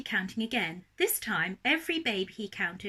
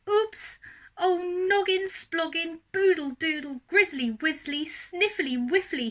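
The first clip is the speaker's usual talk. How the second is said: high, louder exasperated outcry; crying out; 7 semitones higher, 3 dB louder than usual. A narrator's reading. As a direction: cry out in dismay. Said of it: "he cried".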